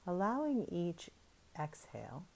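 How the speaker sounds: normal talking voice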